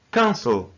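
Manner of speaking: cancel stressed as CAN-cel; careful model of CAN-cel; stress on first syllable. Shown as a demonstration. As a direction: say cancel with a strong CAN and falling second syllable. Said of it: "the first syllable is stressed".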